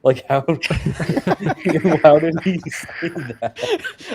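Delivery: laughing disbelief. Real, not acted. Laughing disbelief after quote.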